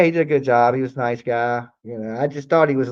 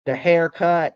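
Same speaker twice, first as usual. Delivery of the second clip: usual speaking voice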